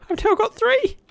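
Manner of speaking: high, excited shout; thrilled. Real, not acted. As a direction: burst out with delight. Gets third trident.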